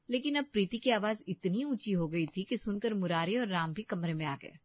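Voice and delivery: lightly voiced storyteller narration; story narration. A narrator's reading. Narrator telling how Murari and Ram came in. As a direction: narrate the next beat calmly.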